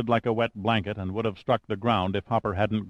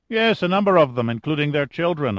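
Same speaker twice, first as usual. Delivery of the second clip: ordinary delivery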